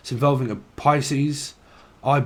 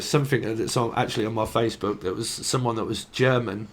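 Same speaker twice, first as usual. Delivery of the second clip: normal talking voice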